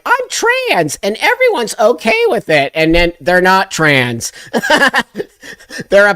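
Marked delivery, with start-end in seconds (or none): 0.0-2.7 high, singsong put-on voice; ridiculing high-pitched imitation; mocking quote. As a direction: mimic a naive announcement up high. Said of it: "they were gonna be like".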